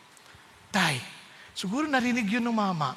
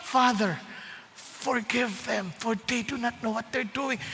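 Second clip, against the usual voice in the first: forgive them gently; tender forgiveness; 6 dB quieter, slower than usual. Acted. Voicing Jesus on cross.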